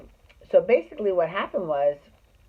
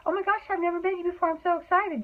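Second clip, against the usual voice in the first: much higher, fast fake squeal; mock gushing; 13 semitones higher, faster than usual. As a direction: play up a phony fangirl thrill. Mocking a fake first meeting.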